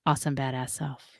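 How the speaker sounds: sexy woman voice. Pretend. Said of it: "I'm gonna use my sexy woman voice and say".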